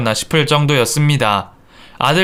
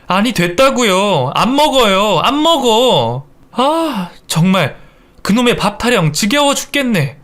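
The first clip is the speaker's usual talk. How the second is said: exasperated refusal; 7 semitones higher than usual. Acted. Daughter-in-law refusing food from mother-in-law, fed up. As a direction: refuse with rising annoyance and a sigh.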